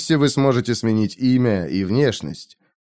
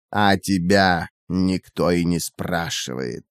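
cold woman's voice; 6 semitones lower, 4 dB quieter, slower than usual. A narrator's reading.

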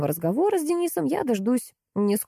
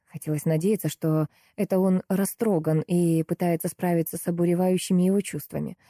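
usual speaking voice

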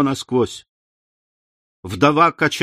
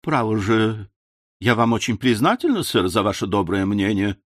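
plain reading voice

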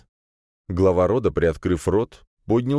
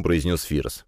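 plain reading voice

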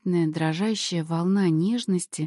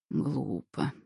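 low, soft breath of speech; hushed, low exhalation; exhaled word; 7 semitones lower, 7 dB quieter than usual. A narrator's reading. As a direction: let it out on a spent breath. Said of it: "выдохнул Велигор".

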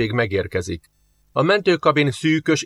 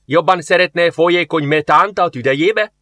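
sarcastic angry retort, higher and louder; officer losing patience with a complainer; flaring up; 6 semitones higher, 4 dB louder than usual. A narrator's reading. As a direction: flare up at the soldier. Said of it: "fortyant fel Jarisov".

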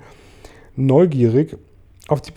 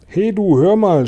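high, loud hail; shouting through cupped hand; 8 semitones higher, 5 dB louder than usual. A narrator's reading. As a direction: call up to someone far off. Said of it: "schrie der Polizeichef und legte die Hand wie ein Trichter". (laut hochrufen)

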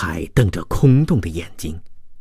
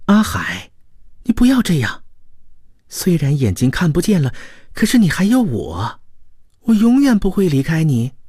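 tender; 10 semitones higher than usual. A narrator's reading. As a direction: reassure him with aching tenderness. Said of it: "柔情似水". (柔情似水地安慰)

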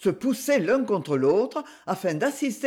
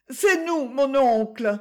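high, raised call up to the shutters; calling up to a window; 6 semitones higher than usual. Acted. Young couple calling up to their uncle at night, high raised call. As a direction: nephew calls his uncle from the street, high raised voice.

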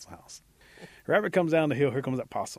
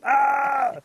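high, loud "aah"; sustained high-pitched cry; victim's scream; 12 dB louder than usual. A narrator's reading. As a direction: yelp as if being mauled. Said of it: "He's going".

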